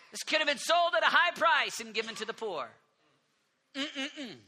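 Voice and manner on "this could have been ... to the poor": loud, high outraged protest; shrill disciple griping about wasted money; indignant. Acted. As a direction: complain with mock outrage at the waste. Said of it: "They're indignant".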